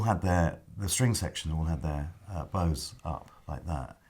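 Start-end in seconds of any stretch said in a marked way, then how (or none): none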